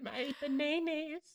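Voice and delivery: high, slow, silly banana voice. Pretend. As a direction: squeak out bananas in a daft voice.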